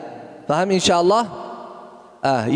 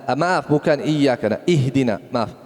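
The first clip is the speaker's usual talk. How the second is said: ordinary talk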